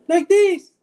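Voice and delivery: very high, loud squeaky falsetto; different character voice. Shown as a demonstration. Showing off different voices, loud comic falsetto. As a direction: jump into a silly puppet-like falsetto. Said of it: "I can do different voices".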